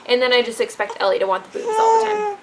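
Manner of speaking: ordinary talk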